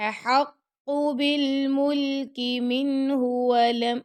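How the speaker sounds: slow, measured tajweed chant; Quran recitation. Shown as a demonstration. Teacher reciting the verse to students, slow and melodic. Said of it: "اور مِنْهُ کر کے نہیں پڑھنا".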